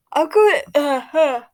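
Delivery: talking through a yawn; words stretched by a yawn. Real, not acted. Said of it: "I keep yawning every three seconds".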